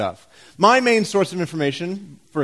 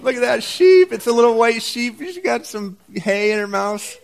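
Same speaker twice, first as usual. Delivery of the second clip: high, louder cooing over an animal; gushing coo; 7 semitones higher, 4 dB louder than usual. Real, not acted. Preacher showing a cute sheep picture to the congregation, cooing.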